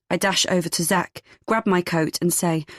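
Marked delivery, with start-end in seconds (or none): none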